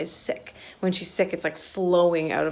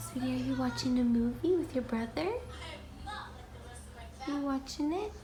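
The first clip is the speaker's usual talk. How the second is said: higher, soft, playful questions; 6 semitones higher, 6 dB quieter than usual. Real, not acted. Mom to her son watching a movie, sweet teasing.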